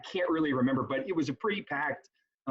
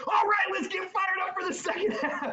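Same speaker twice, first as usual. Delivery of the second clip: hype shout. Retold or imitated. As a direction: fire up the crowd from the stall. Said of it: "all you heard was".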